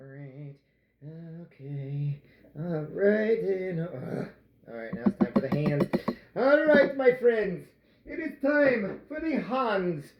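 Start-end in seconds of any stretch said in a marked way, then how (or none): 6.4-10.1 high, drawn-out mock announcement; hammy announcer. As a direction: announce it grandly, pitched up and slowed. Hammy announcement of hand kneading.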